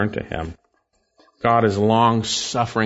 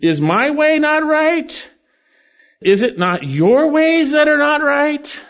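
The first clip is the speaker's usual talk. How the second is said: high, loud, slow thundering rebuke; emphatic; 13 semitones higher, 5 dB louder, slower than usual. Shown as a demonstration. Preacher voices God rebuking Israel, emphatic and loud. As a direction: drive each question home slowly and loud. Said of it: "It is emphatic".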